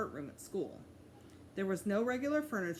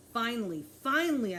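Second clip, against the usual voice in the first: louder, rising exclaimed finally; relieved; 4 semitones higher, 7 dB louder than usual. A narrator's reading. Boy finally reaching Jimmy's place, exclaiming with relief. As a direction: burst out with relief.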